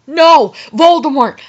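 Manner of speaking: loud, dramatic refusal; shouting back. A narrator's reading. Heroine refusing Voldemort's order, shouting back. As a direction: protest it loudly. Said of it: "I shouted back".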